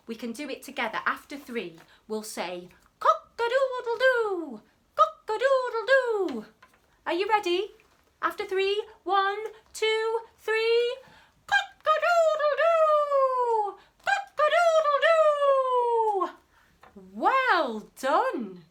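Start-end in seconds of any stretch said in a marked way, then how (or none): 3.0-6.5 high, loud crowing like a rooster. Shown as a demonstration. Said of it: "help me make the sound of a cockerel". 11.5-16.3 very high, loud, drawn-out crowing; rooster crow. Shown as a demonstration. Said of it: "help me make the sound of a cockerel".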